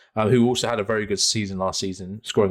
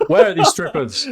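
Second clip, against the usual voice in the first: laughing; 7 dB louder than usual. Real, not acted. Joke sets both laughing.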